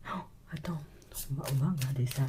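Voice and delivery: breathy hushed aside; soft secret whisper. Acted. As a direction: lean in and whisper it. Psychic reading, secretive aside.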